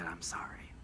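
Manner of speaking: hushed, breathy whisper, voice dropped low; whispered sorry. Retold or imitated. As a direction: breathe the apology without a smile. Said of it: "and he didn't smile".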